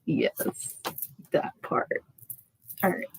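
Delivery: unvoiced whispered aside. Real, not acted. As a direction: whisper it off-mic. Aside barely audible.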